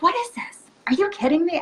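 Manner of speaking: very high, incredulous half-yell; retold yelling. Healer yelling at her spirit guides, shrill mock outrage. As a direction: shriek it in disbelief at your guides. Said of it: "yelling again".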